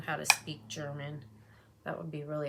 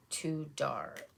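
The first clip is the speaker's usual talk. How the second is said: ordinary talk, nothing marked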